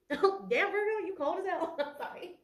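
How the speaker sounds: high-pitched, laughing disbelief; laughing tease. Real, not acted. Tarot reader teasing Virgo for being cold, laughing. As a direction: tease them through laughter, then apologise. Laughing at her own blunt reading.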